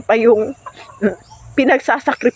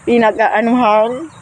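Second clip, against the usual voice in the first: normal talking voice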